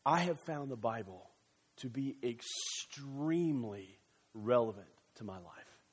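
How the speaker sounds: quiet, low, sincere testimony; sincere believer's testimony. Retold or imitated. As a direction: say it softly, like a heartfelt confession. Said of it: "They all say".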